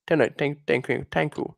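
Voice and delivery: put-on Indian accent. Shown as a demonstration. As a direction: keep up the mock Indian accent. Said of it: "very good Indian accent".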